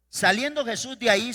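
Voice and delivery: ordinary talk, nothing marked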